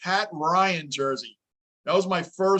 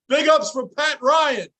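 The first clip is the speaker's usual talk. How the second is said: higher, slow, hammed-up shout-out; playful big cheer; mock hype; 7 semitones higher, 4 dB louder, slower than usual. Real, not acted. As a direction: hype up a nobody player as a joke. Mock-celebrating an obscure player.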